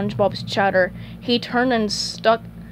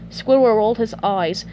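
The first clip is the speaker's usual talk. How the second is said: usual speaking voice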